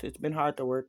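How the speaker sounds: ordinary delivery, nothing marked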